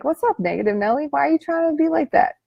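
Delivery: rising, teasing challenge; playful confronting of negative Nelly; sassy call-out. Pretend. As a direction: call out the inner critic cheekily. Calling out inner critic again.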